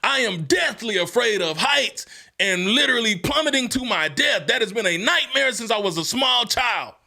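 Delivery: high, pleading retelling of despair; retold despair. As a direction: relive the fear of falling, half-performed. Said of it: "literally crying".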